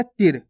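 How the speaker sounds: short i in तिर, unstretched. Shown as a demonstration. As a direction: say तिर quickly with a brief vowel. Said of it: "और इसको कैसे पढ़ा अपन ने?". (तिर में छोटी इ, बिना खींचे)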